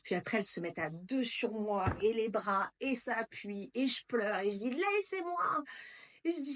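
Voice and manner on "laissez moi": high pained cry to be let go; desperate cry. Retold or imitated. Pinned down by midwives in labour: high desperate cry. Screaming in pain during labour.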